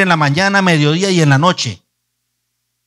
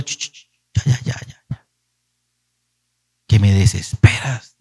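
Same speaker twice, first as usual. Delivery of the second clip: exasperated God shushing; 7 semitones lower than usual. Retold or imitated. God hushing the nagging preacher, exasperated and shushing. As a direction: hush him like a tired parent. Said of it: "Y el Señor".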